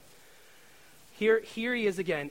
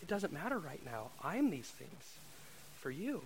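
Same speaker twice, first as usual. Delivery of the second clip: gentle God voice; 11 dB quieter than usual. Retold or imitated. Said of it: "And it was like God saying".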